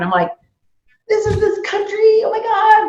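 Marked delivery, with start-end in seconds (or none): 1.1-2.9 high-pitched incredulous squeal; much higher voice, acting out exasperation; exasperated disbelief. Exasperated reply to unambitious young people.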